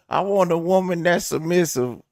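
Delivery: loud, high mock voice; mocking imitation. Comedian imitating men who want submissive women, loud mockery. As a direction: parody a clueless man's demand at full volume. Mocks what everybody says.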